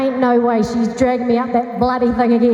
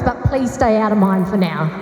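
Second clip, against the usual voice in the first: ordinary talk, nothing marked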